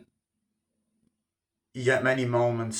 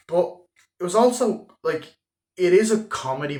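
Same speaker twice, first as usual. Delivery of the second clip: excited; 8 semitones higher, 7 dB louder than usual. Real, not acted. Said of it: "excited emphasis on genre".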